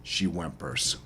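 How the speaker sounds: usual speaking voice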